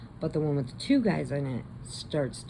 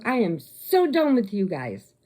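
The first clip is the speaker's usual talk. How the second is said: higher, emphatic fed-up declaration; retold indignation; 5 semitones higher, 4 dB louder than usual. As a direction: break it off as the betrayed girl. Retold betrayed girl breaking it off.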